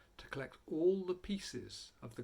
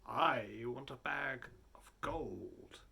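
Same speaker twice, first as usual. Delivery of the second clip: slow, drawn-out robber voice; a robber asking for gold, slow and greedy; 4 semitones lower, 4 dB quieter, much slower than usual. A narrator's reading. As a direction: savour each word like a greedy thief. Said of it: "One of the robbers said".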